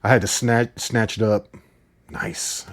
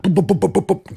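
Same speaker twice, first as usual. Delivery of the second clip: mock gunfire noises; 8 semitones higher than usual. Gunfire sound effect imitation.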